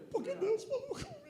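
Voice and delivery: high, laughing triumph. Real, not acted. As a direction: laugh it out with joy. (rindo, voz aguda)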